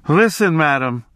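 narrated testy retort; snappish. A narrator's reading. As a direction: snap at her with mild irritation. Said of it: "he snapped".

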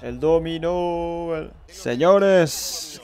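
very slow, drawn-out celebrating words; long triumphant holler; exuberant call. Real, not acted.

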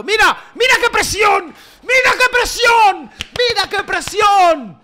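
very high, loud, drawn-out shouts; excited yelling. Real, not acted. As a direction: yell it in wild excitement. Yelling over pressing in game.

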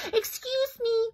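high little critter shout; squeaky animal calling excuse me. Acted. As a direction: call it out high and small. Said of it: "Pika shouted".

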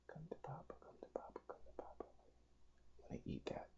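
whispered, creeping hunter; sneaky whisper. Real, not acted. Player stalks a smaller cell in a sneaky whisper. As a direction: breathe it like a predator closing in.

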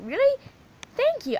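put-on a girl squealing thanks at a compliment; flattered character. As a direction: gush like a delighted little girl.

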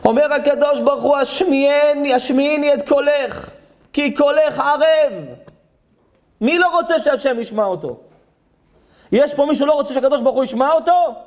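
high, ringing declamation; preaching proclamation. Acted. Rabbi asks who wants God to hear him, proclaiming. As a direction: proclaim the verse and challenge the room. Proclaims verse and challenges the room.

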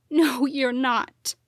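slow, pained cry. A narrator's reading. Daughter crying out to her dead parents. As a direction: plead through tears. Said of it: "I cried".

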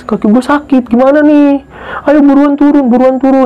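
high, pleading whine, imitated; mock crying. Retelling Mawar crying to be taken down, mock crying. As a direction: act out a tearful friend begging to leave.

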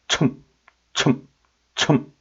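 첨 closed quickly on the m; deep, abrupt sound. Shown as a demonstration. Teacher showing the resulting old pronunciation, 첨 clipped short. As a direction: separate short bursts of 첨. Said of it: "이런 발음이 되겠죠".

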